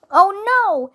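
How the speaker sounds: mild shout, high then falling. A narrator's reading. Villain sees the archenemy arrive, acted shout. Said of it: "shouted Captain Plaque".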